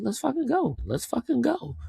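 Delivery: high-pitched, eager cheer; excited hype. Real, not acted. Hyping himself for a campaign.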